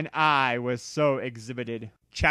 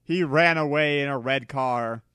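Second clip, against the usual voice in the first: ordinary delivery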